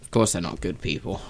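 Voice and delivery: low, hushed agreement. Real, not acted. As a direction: keep it down, dry aside.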